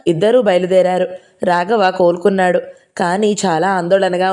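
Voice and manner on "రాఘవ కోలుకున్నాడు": usual speaking voice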